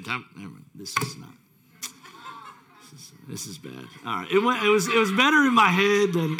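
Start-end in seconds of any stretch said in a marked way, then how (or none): 4.3-6.4 laughing. Real, not acted. Laughing at his failed joke.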